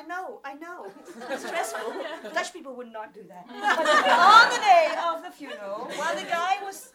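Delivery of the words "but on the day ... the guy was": louder, laughing emphasis on the day; emphatic loud retake. Retold or imitated. Telling the grave story, stressing the funeral day loudly. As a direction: hit it harder the second time.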